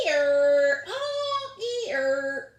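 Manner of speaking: very loud, high yelling bray; angry donkey bray. Acted. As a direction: bray angrily at the stranger. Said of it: "yelling at her".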